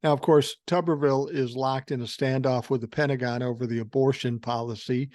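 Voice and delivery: ordinary talk, nothing marked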